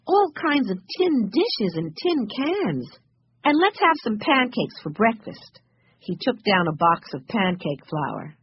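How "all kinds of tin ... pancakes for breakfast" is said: high-pitched, delighted enthusiasm; happy excitement. A narrator's reading. Benny looking over the camping goods, happily excited. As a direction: marvel happily, pitched well up. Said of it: "cried Benny happily".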